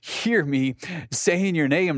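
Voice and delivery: a dad who can't believe he wasn't heard; father retelling his frustration at his son, high and incredulous; exasperated dad. Retold or imitated. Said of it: "I'm like".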